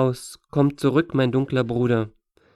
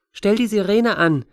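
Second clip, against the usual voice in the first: put-on higher-pitched, insistent little girl; a kid demanding it, pitched up; demanding child; 7 semitones higher than usual. A narrator's reading. Astor demanding the siren from the back seat, demanding child. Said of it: "verlangte Astor".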